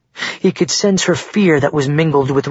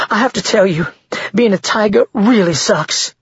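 high-pitched, exasperated woman's voice; rueful female character, pitched up; complaining woman; 7 semitones higher than usual. A narrator's reading. Shaken woman complains to boyfriend.

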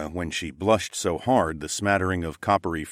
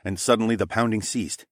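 ordinary delivery

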